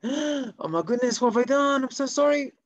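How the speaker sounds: high-pitched, hammed-up dismay; feigned horrified apology. Shamash who spilled tea on purpose, mock-horrified apology.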